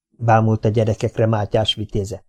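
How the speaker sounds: plain reading voice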